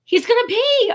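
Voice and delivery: giddy, excited exclamation; excited squeal. Real, not acted. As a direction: burst out with delight at the news. Repeats news excitedly.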